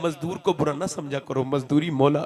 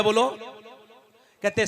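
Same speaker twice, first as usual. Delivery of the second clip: ordinary delivery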